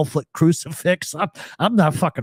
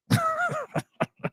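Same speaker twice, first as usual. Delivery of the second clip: high laugh tapering off softer; amused laugh; 6 dB quieter than usual. Real, not acted. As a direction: crack up, then let it die away. Cracking up at own joke.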